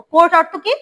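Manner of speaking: usual speaking voice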